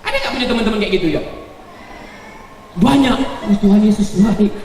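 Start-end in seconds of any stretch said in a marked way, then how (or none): 3.2-4.5 mock pious friend.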